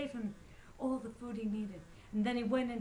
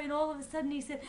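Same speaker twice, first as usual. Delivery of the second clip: ordinary delivery, nothing marked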